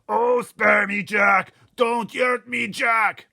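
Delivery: very loud, high, slow terrified bellow; giant bellowing. Acted. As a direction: howl in panic at Jock. Giant begs in nightmare.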